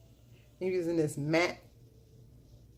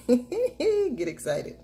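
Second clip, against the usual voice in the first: laughing catchphrase; 8 semitones higher, 7 dB louder, much slower than usual. Real, not acted.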